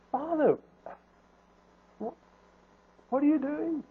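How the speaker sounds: soft, high, gentle plea; loving concern. Acted. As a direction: plead gently, out of love for him. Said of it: "out of love, out of concern".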